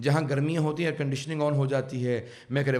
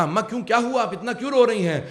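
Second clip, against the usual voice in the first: put-on distressed children; 7 semitones higher, 6 dB louder than usual. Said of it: "بچے تڑپنے لگے".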